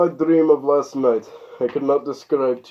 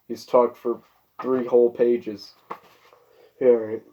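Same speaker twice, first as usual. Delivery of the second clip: ordinary delivery, nothing marked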